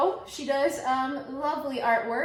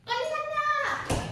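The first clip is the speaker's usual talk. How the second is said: squealed correction; much slower than usual. Pretend. Correcting the list gleefully.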